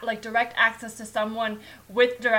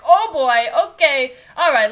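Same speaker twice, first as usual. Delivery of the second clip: camp counselor alarm; 3 semitones higher, 10 dB louder than usual. Retold or imitated. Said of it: "and then you're like".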